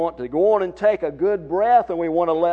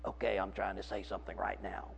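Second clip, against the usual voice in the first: thin, airless and soft, barely any breath; weak starved voice with no breath support; shallow-breath voice; 8 semitones lower, 16 dB quieter than usual. Shown as a demonstration. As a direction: speak on a tiny sip of air. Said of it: "I'll take just a real, very shallow breath and try to say something".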